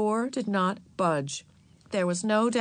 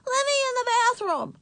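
put-on very high child's demand; screamed; 4 dB louder than usual. A narrator's reading. Ramona demanding the bathroom from the hall, screaming. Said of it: "screamed Ramona".

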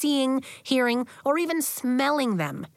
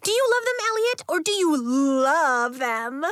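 high and loud teasing; excited; 7 semitones higher, 4 dB louder than usual. Acted. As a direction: gush loudly with glee. Nori still yelling excitedly.

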